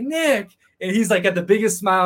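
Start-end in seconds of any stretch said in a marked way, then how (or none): 0.0-0.5 a high, delighted call of "Nick"; happy greeting. Retold or imitated. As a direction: greet an old friend with joy. Husband greets him happily.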